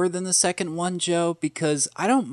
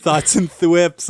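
slow, louder segment title; announcer voice; 3 semitones higher, 8 dB louder, slower than usual. Acted. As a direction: present it like a show host.

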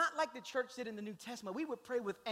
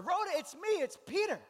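calling out; 10 semitones higher, 6 dB louder than usual. Acted. Peter calls through the door.

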